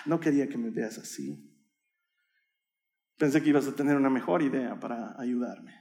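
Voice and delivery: soft, subdued acted shame; sigh, then confess quietly with pauses; ashamed confession. Boy apologising to his mother, ashamed and subdued.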